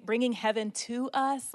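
usual speaking voice

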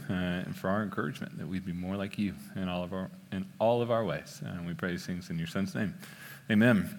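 soft, low closing prayer; hushed pastor's prayer before the sermon. Real, not acted. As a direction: pray it quietly and reverently.

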